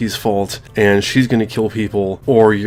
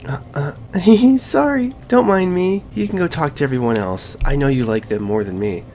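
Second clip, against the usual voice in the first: higher, put-on girl's voice for Sayori; playing Sayori; 5 semitones higher than usual. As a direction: read Sayori's lines in a lifted, girlish voice. Voicing Sayori in visual novel.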